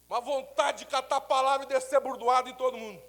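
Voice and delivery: mock fury. Pastor's anger in worship.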